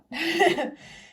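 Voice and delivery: bright little laugh; short laugh. Real, not acted. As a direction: laugh it off lightly. Laughs after telling them not to.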